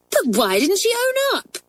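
put-on high, indignant outburst in the girl's voice; indignant cry. A narrator's reading. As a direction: cry it out, outraged at the unfairness. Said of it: "cried Hilary indignantly".

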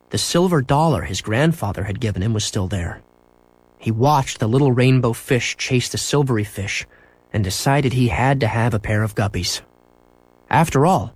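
plain reading voice

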